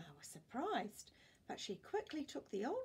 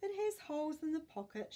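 high, louder Grandma voice; fussing grandma, pitched higher; 8 semitones higher, 5 dB louder than usual. Acted. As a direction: scold gently as a fussing grandma. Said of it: "she said".

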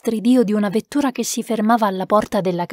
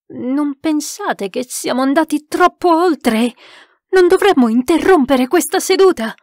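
raised, higher-pitched protest; alarmed cry; 5 semitones higher, 4 dB louder than usual. A narrator's reading. Narrator urges the sitters to end the séance, crying out. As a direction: cry out to stop it, building in volume.

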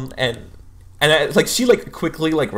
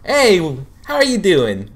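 much higher and louder bubbly greeting; jovial; 7 semitones higher, 5 dB louder than usual. Acted. Counselor greeting the student, jovial and loud. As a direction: beam it out, full of life. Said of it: "very jovial".

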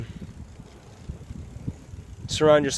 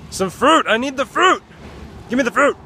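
whiny demand; 9 semitones higher, 5 dB louder than usual. Pretend. Playful craving for fruit.

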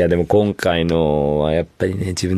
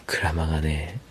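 whispering; 5 semitones lower, 10 dB quieter than usual. Real, not acted. Hushed spoiler reveal.

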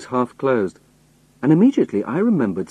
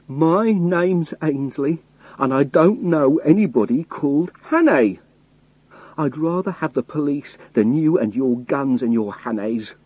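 angry; 6 semitones higher than usual. A narrator's reading. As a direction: insist on the name, getting angry. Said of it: "I started to get very angry".

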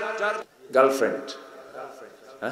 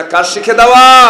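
much louder, higher repeat; loud shout; 9 semitones higher, 15 dB louder than usual. Shown as a demonstration. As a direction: shout the question at the crowd. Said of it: "আসতে বলবেন না".

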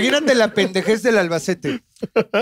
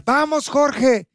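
animando alto y despacio, estirando Jorge; porra imitada; 7 semitones higher, much slower than usual. Retold or imitated. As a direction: echarle porra a Jorge con ganas. (imitando a Sofía echándole porra a Jorge)

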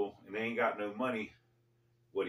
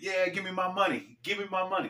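taunting demand; 8 semitones higher than usual. Retold or imitated. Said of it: "you stand right in front of it".

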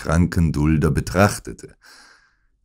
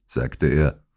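plain reading voice

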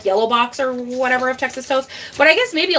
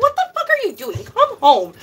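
incredulous yell; 8 semitones higher than usual. Retold or imitated. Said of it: "I was like".

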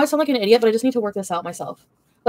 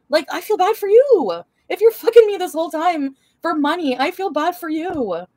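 mock pity; 8 semitones higher than usual. Real, not acted.

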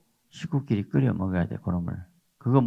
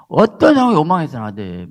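loud, firm preaching; stern preacher's rebuke; forceful rebuke; 7 semitones higher, 11 dB louder than usual. Real, not acted. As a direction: press the point hard on the listeners.

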